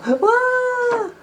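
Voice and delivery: very high, loud panicked cries; two little rabbits screaming for help; wailing for help. Acted. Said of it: "began to wail".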